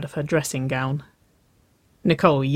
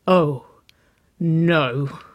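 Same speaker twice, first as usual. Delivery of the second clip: breathy; 4 dB louder than usual. A narrator's reading. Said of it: "Nicole breathed".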